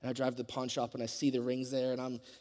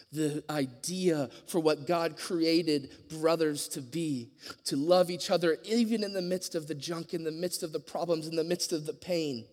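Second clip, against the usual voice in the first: rising preacher voice, higher and louder; building sermon; 4 semitones higher, 6 dB louder than usual. Acted. Preaching to the congregation about brothers, building passion. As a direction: lift the congregation with each phrase. Sermon climax over music.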